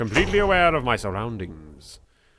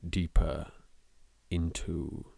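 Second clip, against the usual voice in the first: softer and lower, slowing to a hush; ominous fade; 4 semitones lower, 11 dB quieter, slower than usual. Acted. Entering the mansion alone, narrated in a low fading hush. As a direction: let it sink into darkness.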